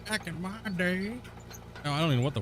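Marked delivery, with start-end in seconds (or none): 0.1-1.1 put-on softly creaked old-timer, slightly higher; old guy voice. Streamer attempting a character for viewers, old guy voice. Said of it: "my old guy voice".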